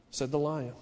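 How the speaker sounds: normal talking voice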